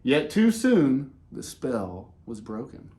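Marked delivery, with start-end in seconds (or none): none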